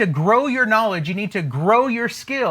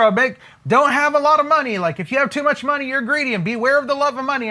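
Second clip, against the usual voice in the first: higher, fast mock scolding; hurried high-pitched parody of a moralizer; prosperity police; 5 semitones higher, much faster than usual. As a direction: imitate a fussy finger-wagger rattling off warnings. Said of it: "by being prosperity police".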